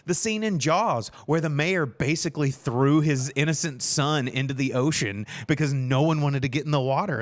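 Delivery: normal talking voice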